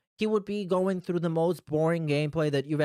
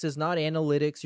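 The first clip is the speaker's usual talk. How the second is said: usual speaking voice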